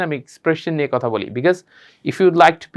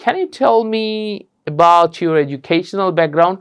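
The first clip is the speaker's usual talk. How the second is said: Can you tell me about your educational background, slow and clear; slow model question; much slower than usual. Shown as a demonstration. Teacher asking students to follow the slow model. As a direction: say it slowly so they can follow. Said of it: "just follow করেন".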